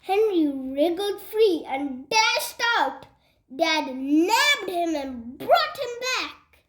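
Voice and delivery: ordinary delivery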